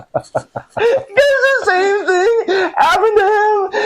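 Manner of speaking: high squeal through real laughter; cracking up, barely getting it out; helpless laughter. Real, not acted. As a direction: wheeze the words out, slow and very high. Overcome with laughter at the punchline.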